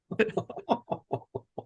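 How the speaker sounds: a high, breathless burst of laughter; high breathy laugh. Real, not acted. Laughing at the customer's punchline, high breathless laugh. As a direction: laugh almost without voice, up high.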